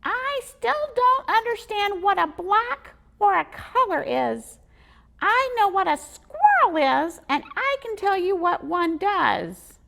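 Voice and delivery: high, loud squeaky squirrel; proud little squirrel explaining. Acted. Squirrel explaining to the boy, squeaky.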